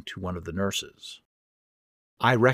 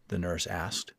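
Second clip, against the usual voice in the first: usual speaking voice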